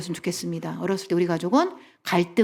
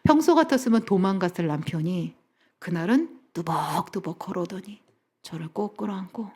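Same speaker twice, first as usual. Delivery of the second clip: ordinary delivery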